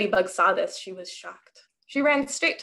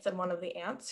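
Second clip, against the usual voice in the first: ordinary delivery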